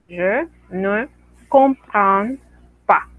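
"comprends" said very slowly with a nasal an; slow nasal model. Shown as a demonstration. Teacher showing students the nasal an, slowly. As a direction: stretch out "comprends" and keep the an nasal. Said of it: "esa an es nasal".

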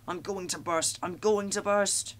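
from strained dread to a slow panicked cry; groaning in alarm. A narrator's reading. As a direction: engine about to burst, building panic. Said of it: "groaned Thomas".

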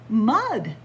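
ordinary delivery

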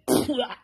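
mock retching with a spat-out burst; imitated vomiting. Shown as a demonstration. Woman showing listeners how they vomit, mock retching. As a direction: fake a noisy throw-up. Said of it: "this way".

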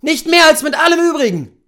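high, loud bitter snap; bitter retort. Acted. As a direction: throw it back in his face. Husband snaps back at hunter.

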